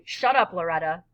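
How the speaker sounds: plain reading voice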